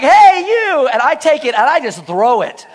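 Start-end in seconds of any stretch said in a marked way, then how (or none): 0.0-0.9 loud, high call across the theater; shouting guy. Retold or imitated. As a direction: bark it at the man with the ball. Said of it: "And the guy's like".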